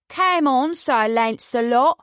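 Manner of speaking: bad mock-Cockney accent, high and loud. A narrator's reading. Cocky kid goading a slow player, terrible Cockney accent.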